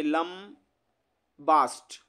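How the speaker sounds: लंबास्ट drawn out very slowly in two parts; split for memorizing. Shown as a demonstration. Teacher helping students remember the word, लंबास्ट slowly split in two. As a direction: break लंबास्ट into "lam", pause, "baast". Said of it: "आप इसको ऐसे याद रखिए".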